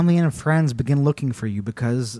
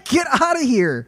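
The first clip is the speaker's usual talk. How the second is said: high-pitched mock protest; panicked refusal; 12 semitones higher, 4 dB louder than usual. Victim sends whispering monster away, high mock-panicked protest. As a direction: squawk it to drive someone off.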